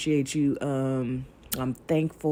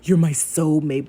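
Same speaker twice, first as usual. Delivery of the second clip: mock gushing; 4 dB louder than usual. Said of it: "You know, not no, like".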